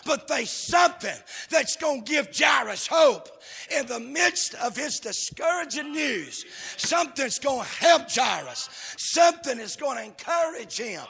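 high, driving pulpit delivery; impassioned revival cadence; fervent preaching. Real, not acted. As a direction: preach in urgent, punched phrases toward a climax. Preaches at full force.

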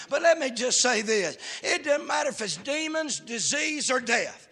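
emphatic revival cadence; fervent preaching. Real, not acted. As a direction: build a list with rising intensity, then proclaim it. Preaches at full force.

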